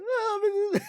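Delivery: squeaky emotional break mid laugh; cracking high voice. Retold or imitated. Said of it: "My voice cracked like freaking my boy Jonesy's".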